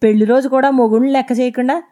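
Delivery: put-on a drunk husband picking a fight, loud and high; angry drunk husband accusing; drunken accusation. A narrator's reading. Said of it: "అన్నాడు".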